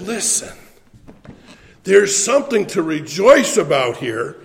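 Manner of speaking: loud building preacher cadence, hammering each "all"; rising sermon climax, loud and insistent; preaching at full force. Acted. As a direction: preach it, driving the list home.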